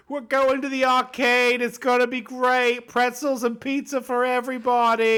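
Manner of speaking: high, put-on sing-out; mock cheery mom. Mom calling date plans to the parents, high mock cheer. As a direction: announce the outing in a high, hammed-up mom voice.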